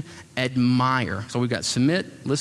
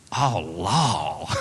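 Southern exclamation; 7 semitones lower than usual. Retold or imitated. Woman's reaction retold.

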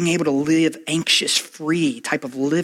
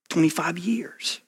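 whispered aside; 2 semitones higher, 4 dB quieter than usual. Real, not acted. Wistful aside about old song.

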